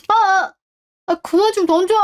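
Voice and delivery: high yelp, then a protest; pained yelling. Acted. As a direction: cry out as things hit you. Son hit by thrown objects.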